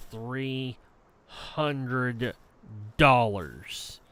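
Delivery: each word set apart, low and quiet; pointed answer. Retold or imitated. Furious tenant telling landlady the cost, deliberate and spaced out. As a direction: answer word by word with long pauses.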